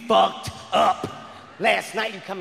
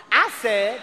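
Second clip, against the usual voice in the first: ordinary talk, nothing marked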